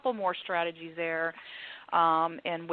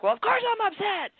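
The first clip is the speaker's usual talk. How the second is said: exasperated upset person. Retold or imitated. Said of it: "the person is like".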